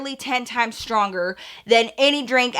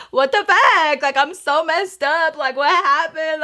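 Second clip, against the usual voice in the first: a touch higher, confused; lightly voiced drunk bewilderment; dazed drunk self; 4 semitones higher than usual. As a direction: replay your drunk confusion. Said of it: "Just like".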